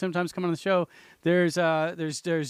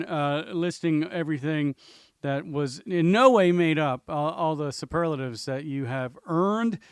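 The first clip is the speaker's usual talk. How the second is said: usual speaking voice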